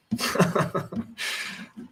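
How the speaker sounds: breathy chuckle at own joke; light, breathy laugh; amused laugh. Real, not acted. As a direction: chuckle to yourself after a quip. Laughing at own joke about Tom.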